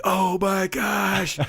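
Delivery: high, slow, drawn-out oh my gosh; kids' stunned gasp. Retold or imitated. Class gasping at the proposal, high and drawn out. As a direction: stretch it out in awe. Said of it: "They're like".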